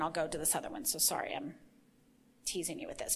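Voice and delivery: soft, low aside; murmured apology. Real, not acted. Preacher apologizing for teasing listeners, murmured low. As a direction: drop to a hushed, apologetic undertone.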